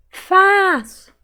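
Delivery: "Faço" with a loud, high, long FA; stretched stressed syllable. Shown as a demonstration. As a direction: call out "Faço", holding FA. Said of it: "Qual que eu demorei mais falar".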